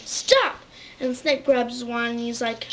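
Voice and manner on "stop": high, loud command; high-pitched shout to halt; sharp stop. Retold or imitated. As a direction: cut them off sharply.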